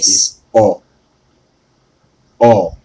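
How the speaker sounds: "All" said like all, low and soft; pronouncing awe. Shown as a demonstration. Correcting the friend: "All" said as awe. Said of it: "The word is A W E".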